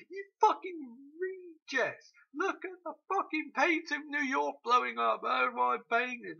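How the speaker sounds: high, loud and slow frantic yelling; shouting. Retold or imitated. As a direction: yell at the screen in disbelief. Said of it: "And I was just shouting".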